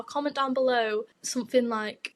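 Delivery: ordinary talk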